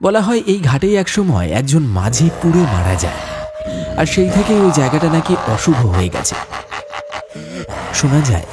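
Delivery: low, grave storyteller voice; story narration. A narrator's reading. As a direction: narrate the ghost legend ominously. Narrator voice between scenes. (গম্ভীর গল্পকথকের বর্ণনা)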